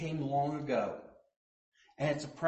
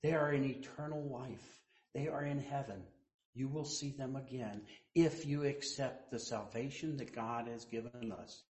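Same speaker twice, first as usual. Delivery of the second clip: ordinary delivery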